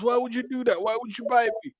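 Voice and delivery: high aggrieved whine; whiny complaint. Retold or imitated. The boy whining after being bitten, high and aggrieved. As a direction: whine like a hurt kid. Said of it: "he goes".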